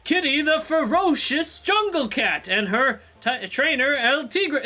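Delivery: loud, high ringmaster introduction; stage announcer. Acted. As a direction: announce the act grandly, drawing out the words. Reads a show announcer's introduction.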